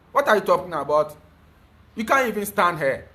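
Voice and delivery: ordinary talk, nothing marked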